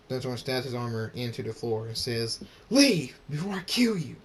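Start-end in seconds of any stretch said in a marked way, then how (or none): 2.7-4.2 loud, high angry shout; enraged bellowed order; furious threat. A narrator's reading. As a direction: yell it as a final warning. Thunderstorm threatens, banishing Draconix.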